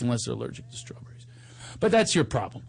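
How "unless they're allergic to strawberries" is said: whispered aside. Acted. Quick disclaimer aside.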